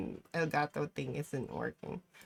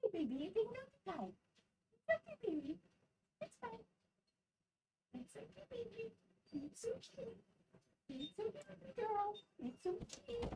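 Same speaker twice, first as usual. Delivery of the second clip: soothing a scared dog; 11 dB quieter than usual. Real, not acted. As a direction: coo gently to calm the dog.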